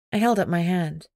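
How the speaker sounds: plain reading voice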